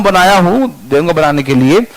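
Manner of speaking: ordinary talk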